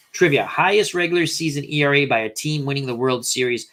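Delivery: ordinary talk, nothing marked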